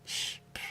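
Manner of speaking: breathy unvoiced shush to silence someone. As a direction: hush them with a soft shh. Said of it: "and then you said".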